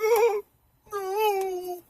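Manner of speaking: very high, thin wail of dread; hammed-up helpless moan; comic dismay. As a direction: whimper it way up high, drawn out. Acts out his dismay.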